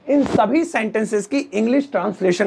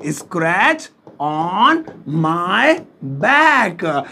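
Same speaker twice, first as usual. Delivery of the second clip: "Scratch on my back" slowly, word by word; teacher's slow model of "Scratch on my back"; 3 semitones lower, much slower than usual. Shown as a demonstration. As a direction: stretch each word of "Scratch on my back", pausing before "back". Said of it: "देखो".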